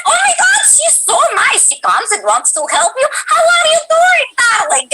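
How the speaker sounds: put-on very high, overly nice Russian accent; hammed-up sugary Russian nurse, way up high; high-pitched Russian nurse. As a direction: gush in a squeaky Russian accent. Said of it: "and it was high pitched".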